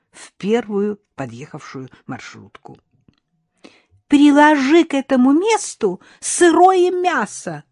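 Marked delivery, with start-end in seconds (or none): 4.1-7.6 high, loud, drawn-out call; shouting after someone. A narrator's reading. Mother-in-law calling after departing minibus, shouting. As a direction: holler it across the street, stretched out. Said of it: "крикнула она вслед отъезжающему микроавтобусу".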